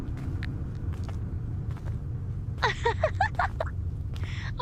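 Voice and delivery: breathy, high teasing laugh; high airy cackle. Acted. Guide laughing at the traveler teetering over the abyss, high and airy.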